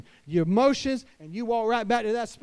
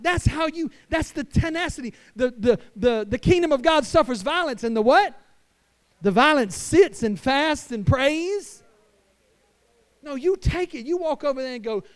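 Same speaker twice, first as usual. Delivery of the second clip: full-force preaching; 5 semitones higher, 8 dB louder than usual. Acted. Pastor preaching to fathers about authority, at full force. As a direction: preach it with rising conviction.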